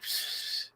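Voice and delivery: a soft unvoiced psst; whispered psst from the toy; toy's whispered psst. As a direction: hiss a secret psst. Toy whispering to get attention.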